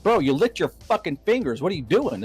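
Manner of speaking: retold indignant scolding. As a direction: scold the finger-licker in disgust.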